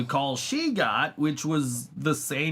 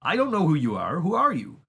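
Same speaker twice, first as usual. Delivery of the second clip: higher, exasperated pushback; exasperated retort; 8 semitones higher than usual. Retold or imitated. Telling off the caller, exasperated and higher. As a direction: push back, baffled and fed up. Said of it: "I'm like".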